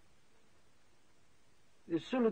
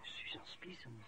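whisper; 6 semitones lower, 13 dB quieter than usual. Acted. Boss echoes the name secretly.

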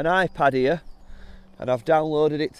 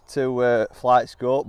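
ordinary delivery

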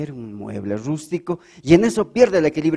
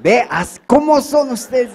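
slow, high, louder mock reproach; mock scolding; 8 semitones higher, 9 dB louder, slower than usual. Comedian scolding the dirty-minded audience, slow and high. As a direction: drag it out scolding the crowd. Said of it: "mock scolding audience".

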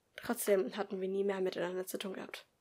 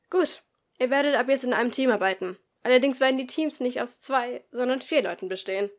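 louder classroom announcement; teacher announcing; 3 semitones higher, 8 dB louder than usual. Acted. Teacher explains the team rules to the class, louder. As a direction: address the whole class clearly.

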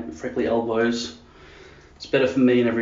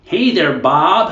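exaggerated American, higher and louder; big effortful American greeting; over-chewed American accent; mock American; 4 semitones higher, 5 dB louder, much slower than usual. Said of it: "calories they must burn while talking".

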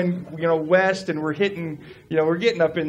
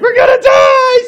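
very high, loud hysterical shriek; imitated crying panic; 15 dB louder than usual. Imitating his wife crying in the car, hysterical shriek. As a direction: wail in exaggerated terror, mimicking someone. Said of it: "She's crying".

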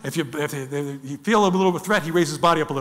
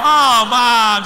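whiny hippo calf; 3 semitones higher, 7 dB louder than usual. Pretend. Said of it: "the hippo's kinda going".

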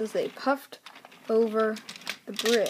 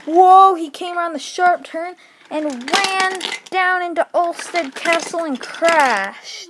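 loud, higher breathless telling; excited crash narration; 6 semitones higher, 9 dB louder than usual. A narrator's reading. Narrator describing Ben's runaway crash, loud and excited. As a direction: tell the crash at full volume.

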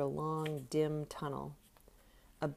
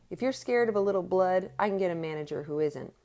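angry threat; 4 semitones higher, 6 dB louder than usual. A narrator's reading. Boxer threatening his manager.

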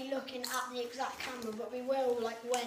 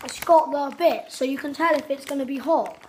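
loud excited; 11 dB louder than usual. Real, not acted. Excited explaining packet.

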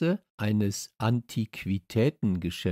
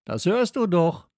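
raised, louder call; 9 semitones higher, 7 dB louder than usual. A narrator's reading. Dog answers boy from upstairs, calling down. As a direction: call it down to someone below. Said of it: "rief ich hinunter".

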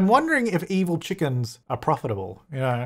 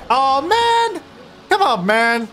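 much higher, louder oh man; exasperated cry; 11 semitones higher, 5 dB louder, much slower than usual. Real, not acted. Gamer seeing the Grim Reaper arrive again, high loud exasperated cry. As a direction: groan at the Reaper's return.

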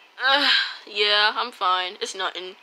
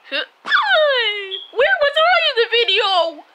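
high eager little character, louder; chirpy kid voice, much higher and fast; squeaky character voice; 10 dB louder than usual. Acted. New character arriving excited for the party.

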